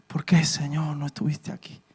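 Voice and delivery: very soft, low, sorrowful plea; tearful Mary. Acted. Preacher acts Mary weeping at Jesus' feet, hushed grief. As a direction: softly imitate grieving through tears. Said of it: "y llorando le dijo".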